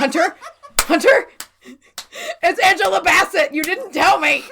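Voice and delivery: high mock wail of betrayal; retold shocked outcry. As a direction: call out in exaggerated disbelief. Retells shocked call to Hunter.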